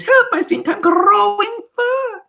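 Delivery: witch mimicking a screaming boy; very high, loud panicked squeal; mock scream. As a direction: scream it in gleeful mock terror. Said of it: "next to her screams".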